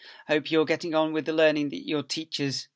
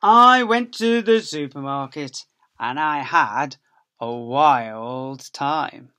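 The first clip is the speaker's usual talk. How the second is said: put-on reading to children; 10 dB louder, much slower than usual. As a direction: read it out big and lively for little ones. Reading the picture book aloud.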